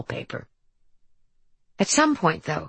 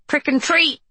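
put-on muffled girl's voice; 10 semitones higher, 3 dB louder than usual. A narrator's reading. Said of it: "came a muffled voice, a girl's voice".

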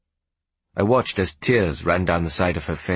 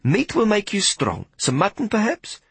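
put-on higher, coaxing Hetty tempting the boy to eat; 13 semitones higher than usual. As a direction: sweet-talk him into eating meat. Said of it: "she said coaxingly".

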